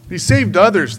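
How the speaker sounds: loud, high sneering scoff. Acted. Priests scoffing loudly at Jesus, sneering. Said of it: "they scoffed".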